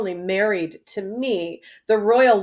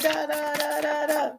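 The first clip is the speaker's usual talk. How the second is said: sung da-da trumpet call, softer; trumpet fanfare; 4 semitones higher, 6 dB quieter than usual. Retold or imitated. Trumpets sounding as the duel begins, sung. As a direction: mouth the fanfare for the fight. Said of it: "And they blow the trumpets".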